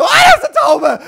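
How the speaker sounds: very high, very loud scream; panicked shriek. Retold or imitated. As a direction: shriek in shock at the pigeon. Discovering pigeon in kitchen. (panischer, hoher Schrei)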